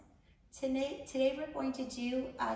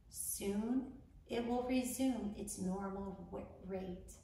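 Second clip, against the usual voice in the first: unhurried instructions, leaving room to breathe; guided meditation; 4 dB quieter than usual. Acted. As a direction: guide a panicked listener calmly through each breath.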